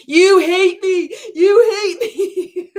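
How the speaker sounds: high, loud, slow fake crying whine; mock sobbing. Mocking the coach as a crying victim, hammed-up sobbing. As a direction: wail it like a hurt child, hammed-up. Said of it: "He's gonna be like".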